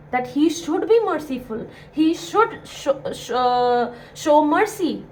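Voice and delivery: ordinary talk, nothing marked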